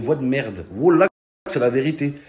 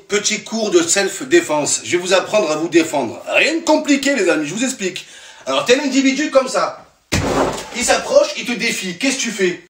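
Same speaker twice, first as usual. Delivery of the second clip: high, eager hammed-up teacher; mock instructor; 5 semitones higher than usual. Comic playing an instructor teaching students, high and eager. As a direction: demonstrate like a cocky coach.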